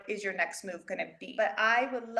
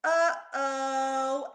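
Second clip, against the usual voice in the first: put-on sheepish little kid uh-oh; a guilty little oops; little brother uh-oh; 3 semitones higher than usual. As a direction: kid brother caught after a crash, sheepish. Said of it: "you hear him go".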